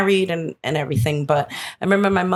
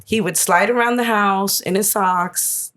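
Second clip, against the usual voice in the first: ordinary delivery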